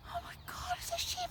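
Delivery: high breathy shock; high whispered surprise. Real, not acted. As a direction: gasp it out high. Sheep suddenly seen.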